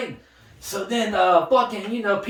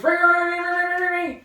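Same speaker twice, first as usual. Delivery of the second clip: bell imitation; 9 semitones higher than usual.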